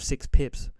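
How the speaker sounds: normal talking voice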